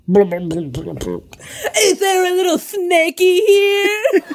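high, drawn-out coo to a pet; cooing call. Pretend. Player luring a snake in the kitchen, high cooing call. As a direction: sing it out high and slow, sweetly luring.

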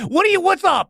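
high, pressing voice; impatient spirit. Acted. Spirit asks Albie for the request, impatiently.

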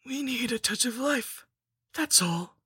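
high villainess making light of it; high voice, dropping on the last words; scheming lich, coaxing. A narrator's reading. Lich explaining her ritual, in a high coaxing villainess voice.

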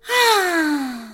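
long tired sigh; weary sigh. Acted. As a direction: let out a heavy sigh. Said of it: "He sighed".

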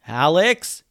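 loud call rising at the end; yelling. A narrator's reading. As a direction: holler a friend's name. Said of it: "yelled Ben".

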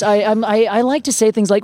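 ordinary delivery

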